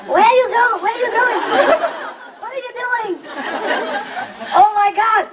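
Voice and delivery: high, squawky bird voice, laughing; talking parrot. Retold or imitated. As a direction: mimic the pet birds calling out their phrases. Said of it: "they speak English even".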